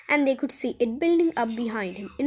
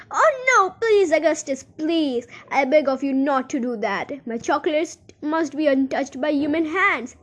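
Mr Wonka pleading; 2 semitones higher, 4 dB louder than usual. Acted. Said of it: "he cried out".

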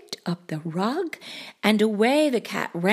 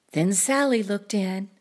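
usual speaking voice

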